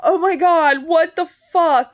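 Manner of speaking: high scared cry, slowing down; frightened cry. Real, not acted. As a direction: gasp it out in real fright. Horror scene, scared reaction.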